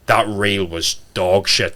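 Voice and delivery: very slow, deliberate put-down; blunt verdict. Retold or imitated. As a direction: lay each word out bluntly. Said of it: "I'd rather you turn around and go".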